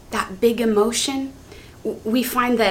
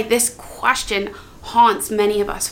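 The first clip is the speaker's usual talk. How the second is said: ordinary talk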